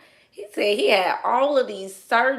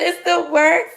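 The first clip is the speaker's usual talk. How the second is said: laughing; 9 semitones higher, 8 dB louder than usual. Retold or imitated. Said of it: "He said".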